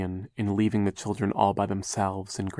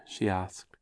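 normal talking voice

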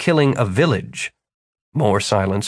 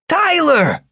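high-pitched greeting to a friend; delighted old friend; 3 dB louder than usual. A narrator's reading. Jimmy sees Tyler back, delighted. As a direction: hail him like a long-lost friend. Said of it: "took on the attitude of a man who has just bumped into an old friend".

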